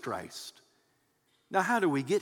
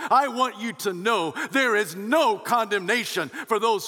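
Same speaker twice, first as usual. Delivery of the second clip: "no condemnation" called out higher and louder; megaphone proclamation; 6 semitones higher, 3 dB louder than usual. Retold or imitated. Apostle announcing to believers, as if through a megaphone. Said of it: "writes it with a megaphone".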